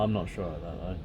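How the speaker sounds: usual speaking voice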